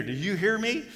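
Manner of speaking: mock stern parent. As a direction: demand an answer like an angry parent. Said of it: "If you hear".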